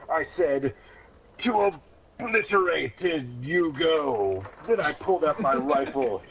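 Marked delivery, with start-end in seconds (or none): none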